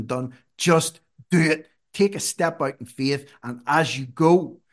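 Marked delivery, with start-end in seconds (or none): 0.6-3.3 emphatic. Real, not acted. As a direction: hammer home just do it. Emphatic exhortation.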